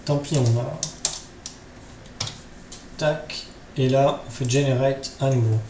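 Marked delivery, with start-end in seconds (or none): none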